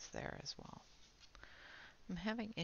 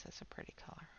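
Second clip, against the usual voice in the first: whispering; 11 dB quieter, faster than usual. Real, not acted. Admiring the color to herself.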